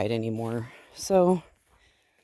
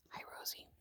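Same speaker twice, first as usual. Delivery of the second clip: barely voiced hello; whisper; 24 dB quieter, much faster than usual. Real, not acted. Owner greeting Rosie the sheep in a whisper. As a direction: breathe the greeting without voice.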